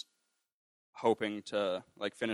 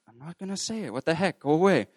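high-pitched mock protest, building louder; indignant refusal; 8 semitones higher, 5 dB louder than usual. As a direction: squeal a hammed-up refusal, getting louder. Retold argument refusing God's request.

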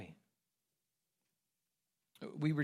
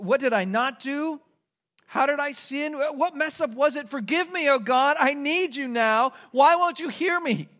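rising, louder anguished pleading, higher; a plea that climbs toward a cry; desperate prayer; 9 semitones higher, 6 dB louder than usual. Retold or imitated. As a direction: beg God to answer you. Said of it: "And then you wonder".

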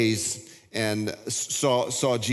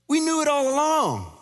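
much higher, louder sudden exclamation; awestruck cry; 4 dB louder than usual. Acted. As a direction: burst out with the realization. Disciples' realization voiced.

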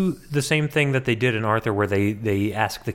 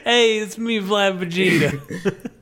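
high, drawn-out character voice; mock character introduction; 7 semitones higher, slower than usual. Host plays the made-up brother, high goofy voice. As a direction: introduce yourself as a goofy cartoon relative. Joking as invented brother Vlad.

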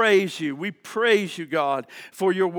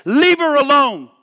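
commanding rebuke; 8 semitones higher, 7 dB louder than usual. Acted. Hear the voice of the Holy Spirit today, say.

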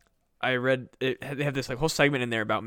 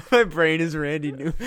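laughing; 4 semitones higher, 7 dB louder than usual. Real, not acted.

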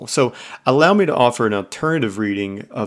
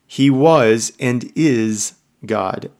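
slow emphatic; 3 dB louder, much slower than usual. Real, not acted. Emphatic slow sermon point.

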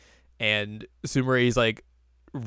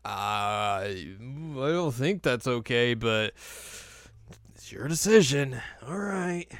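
disapprove but let it go; doubtful resignation; slower than usual. Retold or imitated. Host voicing a doubtful classmate shrugging off a bad deal, slow and reluctant. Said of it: "And Sumari's like".

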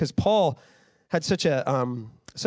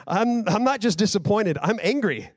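raised, heated paraphrase of an angry apostle; fired-up Paul; 7 semitones higher than usual. Retold or imitated. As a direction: scold them like a hurt, angry father. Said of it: "that's kind of what Paul's saying".

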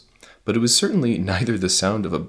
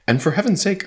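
higher, louder exasperation; exasperated outburst; 13 semitones higher, 6 dB louder than usual. Acted. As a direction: burst out in exasperation. Main character's exasperated quote.

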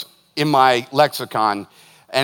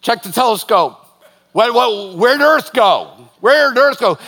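higher, louder panicked alien astronomers; startled aliens; 6 semitones higher, 5 dB louder than usual. Pretend. Aliens at a telescope losing sight of Earth, loud alarmed. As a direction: sound baffled as Earth vanishes.

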